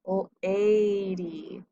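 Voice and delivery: "lady" split as "l", then a long held "ay-dee"; long vowel. Shown as a demonstration. Teacher showing a long vowel in "lady", drawn out. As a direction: stretch the A of "lady" right out. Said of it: "that means long vowel in the first syllable".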